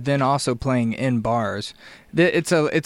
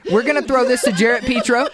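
laughing; 11 semitones higher, 4 dB louder than usual. Real, not acted. Giggling through a joke.

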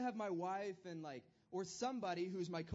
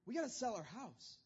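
higher, excited husband announcing news; Abraham to wife; 5 semitones higher, 3 dB louder than usual. Retold or imitated. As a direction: break the news to his wife eagerly. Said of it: "he's like".

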